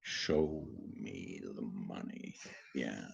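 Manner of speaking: slow, breathless whisper; whispered movie line. Acted. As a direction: hiss it slowly like a secret.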